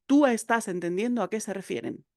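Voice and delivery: normal talking voice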